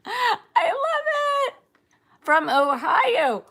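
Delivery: loud, high, delighted cheering; squealing with joy at fans; excited. Real, not acted. Excitedly greeting viewers.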